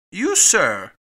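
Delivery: loud, startled exclamation; cried out. A narrator's reading. Fix coming up to Fogg, loud outcry. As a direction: burst out loudly in protest. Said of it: "cried Fix".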